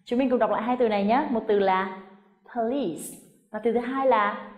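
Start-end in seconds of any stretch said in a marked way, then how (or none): 2.6-3.0 "police" as puh-LEESE with a hissed s; two syllables, final s. Shown as a demonstration. Teacher contrasting two words, two-syllable "police" ending in s. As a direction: give "police" two beats, ending voiceless. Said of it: "gồm có hai âm tiết và âm cuối cùng là âm s".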